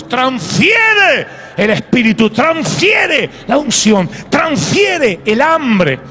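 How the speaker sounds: shouted prayer. Real, not acted. Pastor crying out to God for his people, shouting slowly. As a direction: drag out each plea at full voice.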